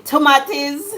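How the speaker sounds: "tomatoes" said too-MAH-tiz; "tomatoes" Jamaican way, too-MAH-tiz. Shown as a demonstration. Telling how Jamaicans say it, "tomatoes" too-MAH-tiz. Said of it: "In Jamaica, some people say".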